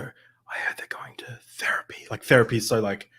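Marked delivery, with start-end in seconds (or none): 0.0-2.1 hushed gossip whisper; scandalised older folks' whisper. Retold or imitated. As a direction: whisper it like a secret. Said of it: "like you whisper it".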